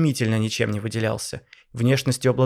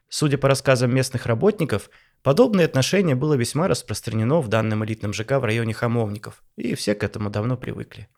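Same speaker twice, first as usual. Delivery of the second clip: ordinary delivery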